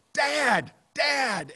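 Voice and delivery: high, loud shout to wake Dad; imitated yelling. Daughter resorting to yelling to wake dad, loud shout. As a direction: yell it like an exasperated last resort. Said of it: "she has to resort to yelling".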